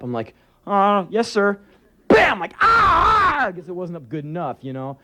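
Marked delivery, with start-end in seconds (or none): 0.7-1.6 lightly voiced kid, pitched higher; a higher, hesitant child's reply; timid little boy. Small boy answers his father. 2.1-2.4 sudden high, loud "bam"; explosive shouted impact noise; imitated punch. 2.6-3.5 very high yelp of pain; acted kid's scream after a punch; mock pain scream. Boy yelps when hit.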